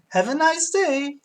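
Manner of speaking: high, slow Italian barber; Italian accent. Acted. Barber sending off the customer, Italian accent. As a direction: sing out an Italian farewell. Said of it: "kinda made him sound like Mario".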